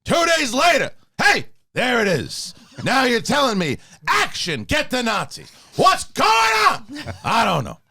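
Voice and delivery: yelling Al Pacino. Acted. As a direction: rant like late-career Pacino. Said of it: "The yelling?".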